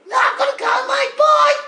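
high, squeaky Brummie sign-off; Dave from Birmingham. Acted. Caller hurriedly saying goodbye to hosts, squeaky Birmingham voice. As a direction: ring off in a put-on high Brummie voice. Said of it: "It's Dave from Birmingham".

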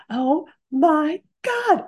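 gasping awe. Retold or imitated. Classmate seeing the panda.

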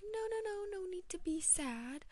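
high-pitched cooing "there, there" imitation; mock consoling principal. Gullible principal comforting the class president, mock consoling. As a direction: coo it in an exaggerated soothing high voice.

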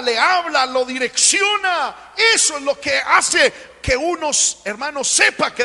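rising, shouted preaching, slowing on lo direcciona; fervent shouting preacher; preaching at full force. Acted. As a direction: drive it home to the congregation with full voice.

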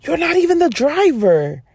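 indignant. Retold or imitated. Retorting to the rude husband, high and indignant. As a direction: push back hard, voice jumping up. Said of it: "I was like".

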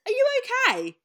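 high, loud sarcastic "are you okay"; mock concern. To kids who said Santa isn't real, high mocking concern. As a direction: sarcastically ask if they're okay. Mocking reply to preachy friends.

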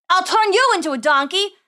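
loud, high angry retort; indignant boy wizard. Acted. Boy wizard threatening man who threw him out, indignant. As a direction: shout back a threat. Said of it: "grumbled the boy".

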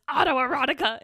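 belted out loudly; all-caps exclamation. Retold or imitated. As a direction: shout it as if written in capitals. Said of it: "in all caps".

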